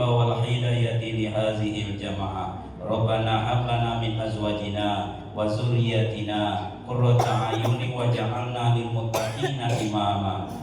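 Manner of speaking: low, measured chanted supplication; Arabic prayer recitation. Real, not acted. Preacher asks God's mercy for the congregation, prayer recitation.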